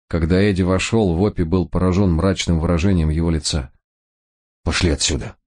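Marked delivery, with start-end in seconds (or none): none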